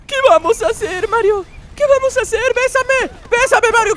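hammed-up damsel begging Mario to kiss her; princess voice. (voz de princesa muy aguda y exagerada, suplicando)

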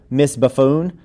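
ordinary delivery

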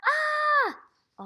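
high, loud mock scream "Ah"; scared ant shout. Shown as a demonstration. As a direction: yell "Ah" like a frightened ant, hands in the air. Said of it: "act like you're scared".

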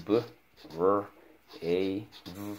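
B, R, A, V sound by sound, long pauses between. Shown as a demonstration. Teacher leads pupil through spelling brave, sounding out. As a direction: separate each sound in B, R, A, V. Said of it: "All right, let's go".

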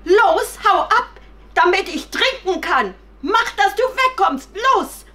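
high, loud angry rabbit; furious shouting. Acted. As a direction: yell to chase someone off. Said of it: "wurde das Kaninchen wütend ... und schrie die Puppe an". (wütend schreiend)

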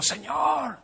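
high, soft plea to the Lord; retold moan to God; a mild mock whine; pleading complaint. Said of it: "le digo".

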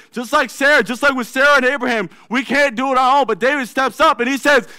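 loud, high, building sermon climax; fired-up preacher voice; preaching at full force. Real, not acted.

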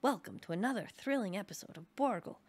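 mock adventure-serial title announcement; grand, swinging announcer delivery; TV show announcer. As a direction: announce a thrilling episode, punching the title.

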